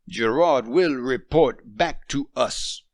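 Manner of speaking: a stern father of the family. A narrator's reading. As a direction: lay down the law to his wife. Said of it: "Maurice said sternly".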